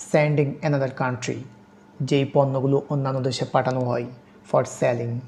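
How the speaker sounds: ordinary talk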